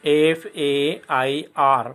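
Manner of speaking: very slow letter-by-letter spelling; teacher spelling fair; spelling aloud. Shown as a demonstration. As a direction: spell each letter deliberately. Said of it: "spelling fair".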